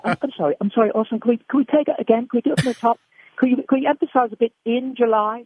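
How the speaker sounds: a politely pleading British voice director; a British director asking for another take. Retold or imitated. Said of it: "all the people directing Orson were British".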